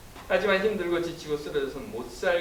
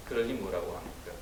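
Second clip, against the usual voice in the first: normal talking voice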